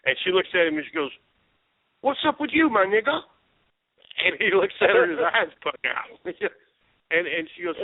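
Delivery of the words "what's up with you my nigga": tipsy newcomer parroting TV street talk; mock streetwise greeting, pitched way up; mimicked TV slang. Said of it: "so I mimicked everything they said".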